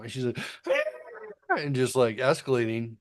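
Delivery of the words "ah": very high shriek with no extra volume; a thin, shrill mock wail; imitated toddler scream. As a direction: escalate the toddler shriek higher. Toddler escalating tantrum, retold.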